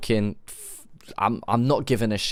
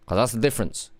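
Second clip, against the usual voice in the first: ordinary delivery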